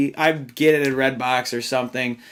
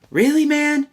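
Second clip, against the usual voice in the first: incredulous; 11 semitones higher than usual. Real, not acted. Mocking the villain's motive.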